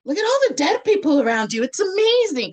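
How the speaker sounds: put-on the healer gushing to the young woman, fast and thrilled; excited healer. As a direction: gush about the dead like it's wonderful news. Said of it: "And then she's like".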